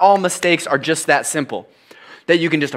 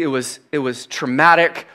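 normal talking voice

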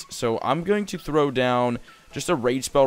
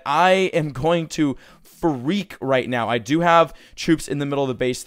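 ordinary talk

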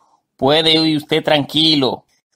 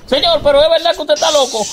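much higher, louder call over the storm; alarmed call; 11 semitones higher, 3 dB louder than usual. Acted. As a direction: shout the warning across. Vecino le grita por la tormenta.

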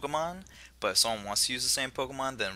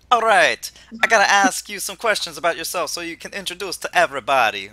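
loud, higher Jamaican professor; attempted Jamaican accent; 5 semitones higher, 8 dB louder than usual. Host as professor asks players about themselves, Jamaican accent. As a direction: belt it out in a hammy Jamaican accent. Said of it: "I can't do a Jamaican accent".

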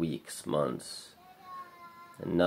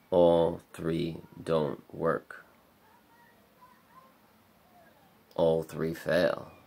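each word laid down slowly and firmly; slow heavy emphasis; 4 dB louder, much slower than usual. Real, not acted. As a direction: spell out the hopelessness slowly. Sums up his three failed choices.

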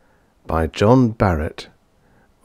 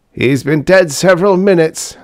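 high, louder voice for a doctor; 10 semitones higher, 6 dB louder than usual. A narrator's reading. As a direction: announce it as the examining doctor. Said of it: "the doctor said".

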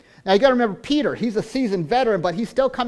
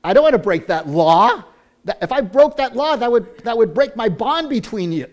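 Peter protesting; 4 semitones higher, 8 dB louder than usual. Acted. Peter refusing the vision's command, loud insistent protest. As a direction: push back hard against the command. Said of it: "Peter says".

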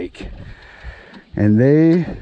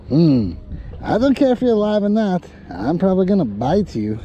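lightly voiced fish, pitched up on care; a hint of a bold fish daring the bait; cocky fish voice; 7 semitones higher than usual. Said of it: "And then the fish says".

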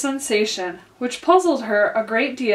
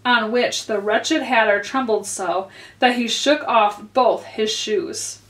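ordinary delivery, nothing marked

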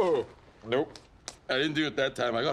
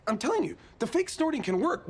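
ordinary talk, nothing marked

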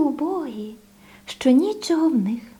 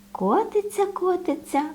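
ordinary delivery

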